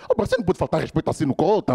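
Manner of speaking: normal talking voice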